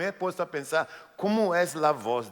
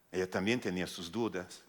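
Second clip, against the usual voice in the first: ordinary delivery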